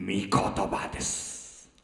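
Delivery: hushed, slow first utterance of 御言葉です; soft breathy murmur. Real, not acted. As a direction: drop to a near whisper, slow. Pastor repeats key phrase.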